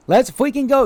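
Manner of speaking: high, excited exclamation; hyped cheer. Real, not acted. Streamer reacting happily to chat, hyped. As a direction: cheer it out with a lifted pitch.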